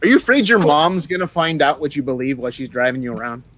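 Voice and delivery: ordinary talk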